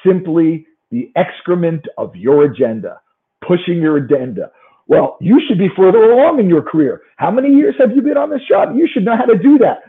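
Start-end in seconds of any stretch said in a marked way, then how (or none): 4.9-9.8 scolding boss. Acted.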